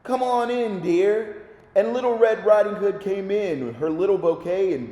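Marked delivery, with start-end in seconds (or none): 0.0-1.4 lightly voiced old granny, a touch higher; wolf hams up a lightly higher granny voice; a hint of sweet old granny; quavering granny voice. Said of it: "called in a quavering granny voice".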